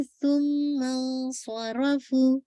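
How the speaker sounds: slow recited सुम्मा सरफ़ू with the hamza dropped; careful teacher's demo of सुम्मा सरफ़ू; shown reading. Shown as a demonstration. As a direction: draw out सुम्मा सरफ़ू slowly, linking the words. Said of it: "ठीक है, इस तरह से".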